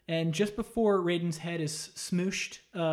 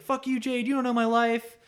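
high, louder mock outburst; lashing out; 7 semitones higher, 5 dB louder than usual. Katana lashing out at Jade, a hammed-up outburst. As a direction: snap at her like a hurt teen. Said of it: "she even lashes out at Jade".

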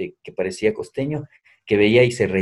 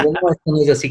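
mock protest; 6 semitones higher, 4 dB louder, faster than usual.

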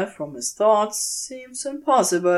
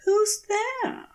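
a much higher, rising "Who's there?"; asking, uneasy. A narrator's reading. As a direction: ask into the trees, a touch wary. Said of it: "Aziraphale asks".

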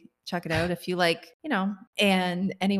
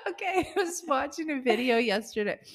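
helpless giggles breaking into the words; laughing to tears; 4 semitones higher than usual. Real, not acted. Co-host tries to start a story, real tearful laughter. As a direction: try to talk while cracking up. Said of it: "now I'm starting to cry again".

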